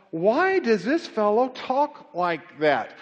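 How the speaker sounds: slow, drawn-out skeptical question; slow doubting quote. Acted. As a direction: let the suspicion drag out slowly. Voicing the doubting teachers.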